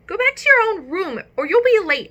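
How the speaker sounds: high, loud squeaky warning; hamster warning. Acted. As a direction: warn him urgently in a squeak. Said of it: "I warned him".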